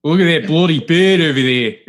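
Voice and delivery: mock hillbilly British accent. Shown as a demonstration. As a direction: mash British and Southern accents together. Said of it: "Just, yeah, hillbilly British".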